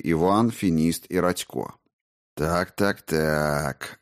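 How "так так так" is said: slow, menacing, quieter drawl; ominous drawl. Acted. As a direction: draw out each word with quiet menace. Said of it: "зловеще протянул царевич".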